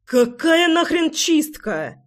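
high, drawn-out outrage at no great volume; narrated roar. A narrator's reading. Man explodes at friends in hospital corridor, mock roar. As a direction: voice a roar by pitch, holding back volume. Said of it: "взревел Райлих. Да так громко".

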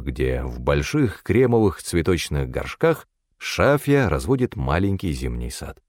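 ordinary delivery

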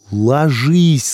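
loud, high urgent order; shouted command. A narrator's reading. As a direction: yell for everyone to drop. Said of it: "скомандовал молодой".

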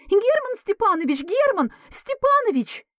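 very high screeching call for the doctor; hysterical shriek. Acted. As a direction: shriek his name in panic. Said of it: "истерично визгливое". (истеричный визгливый крик)

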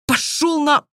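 high cut-off curse; angry curse. A narrator's reading. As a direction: spit it out before being silenced. Heroine cursing at dragon master.

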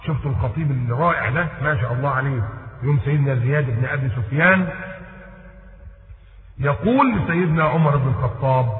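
ordinary delivery

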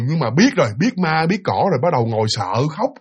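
ordinary delivery

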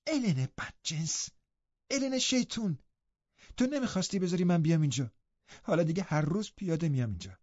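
put-on high, playful girlish scolding; teasing scolding. A narrator's reading. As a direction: tease and chide at once, pitched high. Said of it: "با نیش و نوازش پرید به من".

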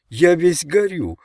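high, drawn-out complaint; protesting. A narrator's reading. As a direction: object plaintively, stretching the words. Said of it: "протестовал Ричард".

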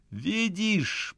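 high urgent near-shout; almost yell it, pleading. A narrator's reading. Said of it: "почти кричу я".

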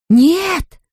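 two women crying out together to stop him; shouted. A narrator's reading. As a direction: blurt a panicked no. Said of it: "хором вскричали Люси и Шарлотта".

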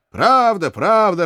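high-pitched cries of agreement; crowd cries. A narrator's reading. Archers backing their comrade, crying out. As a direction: cry it out as a roused troop. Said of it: "раздались крики".

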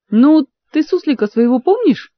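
ordinary delivery, nothing marked

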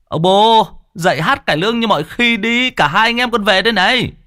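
high, pouty mocking son; pouting cheek. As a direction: tease the sick father. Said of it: "giẩu cái mỏ".

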